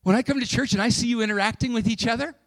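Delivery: loud, high, fervent preaching; full-force preaching. Real, not acted.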